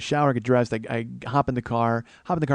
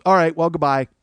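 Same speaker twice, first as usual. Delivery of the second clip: raised, louder sign-off; bluffing goodbye; 5 semitones higher, 7 dB louder than usual. Retold or imitated. Wife threatening the haggler with leaving, raised voice. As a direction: announce you are leaving as a bluff.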